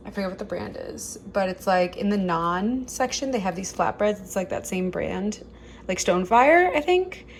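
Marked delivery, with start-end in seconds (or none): none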